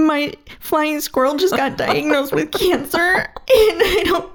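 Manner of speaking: high, half-laughing tearful plea; retold bawling. To the driver at the stop sign, retelling a tearful plea. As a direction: replay the sobbing apology through a laugh. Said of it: "And I'm just bawling".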